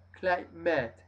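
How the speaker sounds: Climate, final e silent; model pronunciation. Shown as a demonstration. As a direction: say Climate as "KLAI-mat". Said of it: "una E que no suena".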